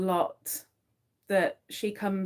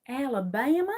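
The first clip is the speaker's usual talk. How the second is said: higher, rising Southern "Alabama"; Southern American accent; 5 semitones higher than usual. Acted. Trying the old Southern act, rising Southern American accent. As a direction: lift the end of "Alabama" like a Southern question.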